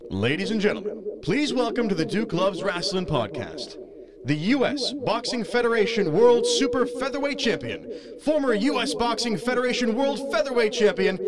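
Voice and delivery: fighting game announcer intro. Shown as a demonstration. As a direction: introduce the champ like a ring announcer. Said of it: "I'm gonna do a real announcer voice for you".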